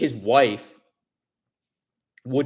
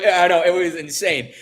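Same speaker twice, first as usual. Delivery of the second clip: ordinary talk, nothing marked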